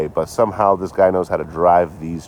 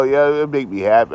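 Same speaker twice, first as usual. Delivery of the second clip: normal talking voice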